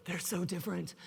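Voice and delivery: low, soft mock lament. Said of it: "he's, like, just lamenting".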